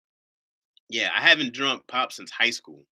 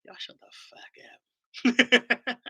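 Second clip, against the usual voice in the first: playful; 13 semitones higher, much slower than usual. Real, not acted. Joking at chat.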